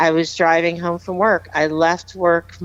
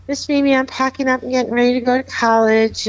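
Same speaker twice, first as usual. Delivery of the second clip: put-on higher, faster, excited young voice; eager teen; 7 semitones higher, faster than usual. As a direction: chirp it like a kid off to college. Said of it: "He's like".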